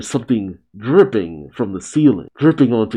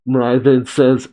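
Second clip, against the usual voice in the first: ordinary talk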